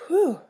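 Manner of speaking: high, loud exhaled "whew"; relieved whew. A narrator's reading. Child flopped down after witch passes, relieved. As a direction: blow out a big breath of relief. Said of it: "very relieved".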